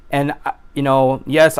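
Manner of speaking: ordinary talk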